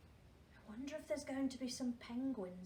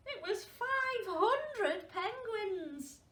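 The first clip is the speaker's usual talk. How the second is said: high, louder and slow; big reveal; 10 semitones higher, 6 dB louder, much slower than usual. A narrator's reading. Storyteller revealing the penguin crowd to children, slow excited reveal. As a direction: let the surprise land big.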